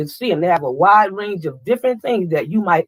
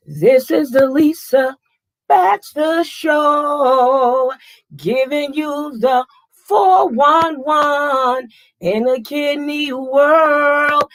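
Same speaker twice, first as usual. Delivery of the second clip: drawn-out, higher announcer intro; show announcer; 9 semitones higher, much slower than usual. Acted. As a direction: stretch each word like a show opener.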